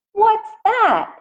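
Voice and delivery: put-on high, puzzled little-girl voice; Madison's surprised question. A girl in the story asking about a new boy's chopsticks, voiced high and puzzled. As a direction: jump up high, curious and puzzled.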